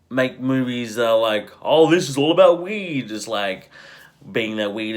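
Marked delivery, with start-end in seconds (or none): 1.6-3.1 mocking voice. Mock movie pitch quote.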